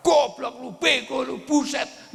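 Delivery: hammed-up angry outburst; imitating an angry shout. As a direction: bark the insults like a hothead losing it. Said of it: "Lihat orang marah".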